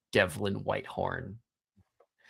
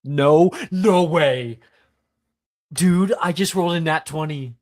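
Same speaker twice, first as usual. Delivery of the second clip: loud, higher disbelief; stunned excitement; 5 semitones higher, 9 dB louder than usual. Real, not acted. Game master reacts to a player's natural twenty, stunned and loud.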